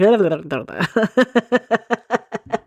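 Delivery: newsreader breaking into giggles; giggling newscaster. Pretend. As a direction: laugh through the news read. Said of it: "if I were on the news reading stuff, I'd be like".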